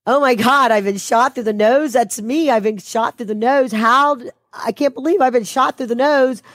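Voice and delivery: high, panicked disbelief at being shot; retold panic. Panicking to himself, convinced he was shot through the nose. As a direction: replay the moment you thought you'd been shot, voice jumping up. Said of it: "I was like".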